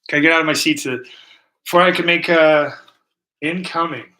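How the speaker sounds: normal talking voice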